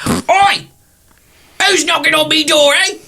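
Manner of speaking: put-on cranky old crone yelling through the dust; tottery old lady. Shack's old lady shouting at the stranger at her door, high and cranky. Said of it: "this tottery old lady".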